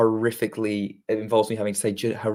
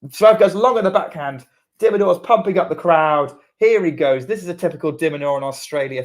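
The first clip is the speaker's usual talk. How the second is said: higher, louder excited call; excited commentary; 5 semitones higher, 6 dB louder than usual. Real, not acted. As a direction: raise the energy as the point ends. Building tense point, crowd pumped.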